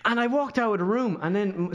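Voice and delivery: usual speaking voice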